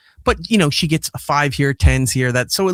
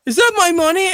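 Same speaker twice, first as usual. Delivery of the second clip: put-on higher-pitched child's question; imitated worried child; 12 semitones higher than usual. Child asks parent about the money, imitated worry. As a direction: lightly voice an anxious kid, pitched up.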